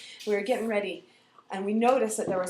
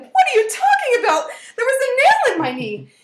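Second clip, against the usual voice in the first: high, loud, outraged wail; acted hysterical protest; 14 dB louder than usual. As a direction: shout back in child's hysterics. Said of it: "my hysterical cries".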